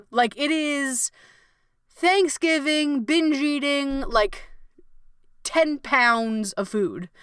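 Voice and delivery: stretched, emphatic overload; drawn-out emphasis. Real, not acted. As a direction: drag each word out to show how much. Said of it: "stretching words for emphasis".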